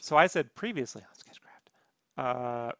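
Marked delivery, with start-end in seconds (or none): 0.6-1.7 whispered show-recap announcer; mock recap intro.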